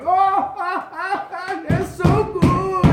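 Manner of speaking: high, loud, drawn-out gloating; cackle, then boom out slow delight; booming laughing voice. Acted. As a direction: mad king reveling, hammed up. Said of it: "this booming voice just laughing".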